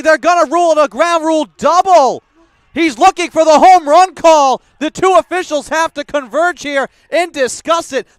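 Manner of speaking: loud, fast, indignant call; excited. Real, not acted. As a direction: protest the ruling, still fired up. Excited caller disputing ruling.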